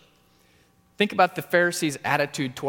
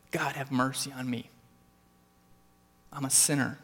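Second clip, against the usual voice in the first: softer, humble whispered-like prayer; quiet prayer; 6 dB quieter than usual. Shown as a demonstration. Preacher modeling the congregation's daily prayer, soft and humble. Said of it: "Come to God every day and say".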